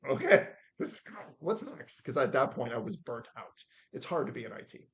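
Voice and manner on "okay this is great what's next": high, loud, eager burst; retold delight. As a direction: act out jumping at a great offer. Retold delight at a big offer.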